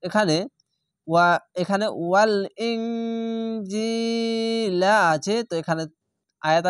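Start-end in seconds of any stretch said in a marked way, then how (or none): 1.1-4.9 ইনজিলা held for a long drawn final vowel; three-alif stretch. Shown as a demonstration. Teacher to students, long held vowel. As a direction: hold the last vowel long. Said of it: "তিন আলিফ টেনে পড়লাম".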